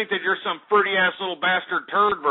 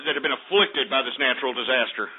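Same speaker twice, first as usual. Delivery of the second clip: ordinary talk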